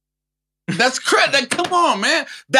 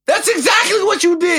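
angry shout; 9 semitones higher than usual. Real, not acted. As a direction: yell it at him. Heated argument with co-host.

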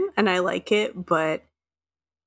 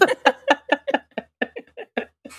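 high laugh; 6 semitones higher than usual. Real, not acted. Laughing after the joke.

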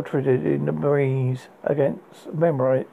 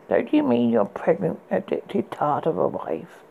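high, sneering sorceress; mock his lie coldly; scornful enchantress; 5 semitones higher than usual. A narrator's reading. Enchantress voiced in story.

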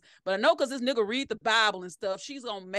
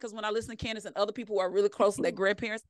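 usual speaking voice

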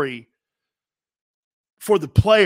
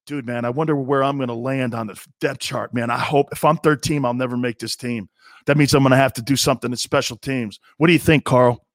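lower, casual player voice fretting about the roster; anxious player; 7 semitones lower than usual. Retold or imitated. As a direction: talk it down like a nervous lineman. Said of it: "as you're getting ready for training camp".

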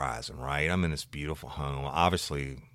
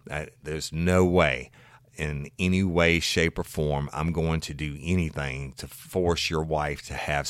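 retold firm lecture; 3 semitones higher, 10 dB louder, slower than usual. Officer firmly lecturing the husband.

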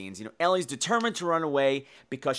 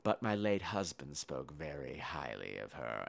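lower, softer sugary matron voice; mock icy matron; 13 semitones lower, 13 dB quieter, slower than usual. Matron answering the new girl, lower and softer with cool menace. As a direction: purr it with quiet venom. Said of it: "And Missus Deer says".

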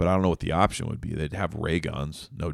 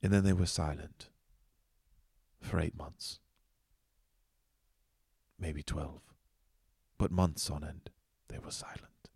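softer, whispered mythic narration; hushed epic narration; 6 dB quieter than usual. Pretend. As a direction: tell it like an ancient legend, very softly. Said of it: "some of that Tolkien silence".

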